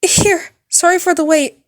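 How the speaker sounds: high, loud panicked apology; high anxious girl voice, loud; nervous nurse. Acted. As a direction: gush out the apology in a fluster. Nurse shakily hands ice bag.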